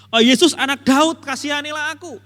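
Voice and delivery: loud pleading cry to Jesus; a loud cry of a desperate beggar. Retold or imitated. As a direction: call out like a blind beggar begging mercy. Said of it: "Dia terus berteriak begitu".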